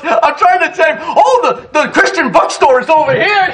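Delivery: high, laughing mock distraction; laughing retelling. Preacher mimics the pastor distracting the visitors, laughing. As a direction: steer them away, laughing. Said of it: "And he goes".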